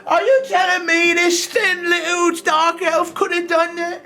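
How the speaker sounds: put-on a furious old drunk shouting across the tavern; angry old drunk. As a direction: rage like a sore loser who lost his bet. Drunk old man keeps ranting.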